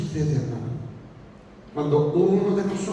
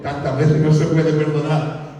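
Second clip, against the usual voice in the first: Peter resigned; 5 semitones lower than usual. Retold or imitated. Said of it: "dice Pedro".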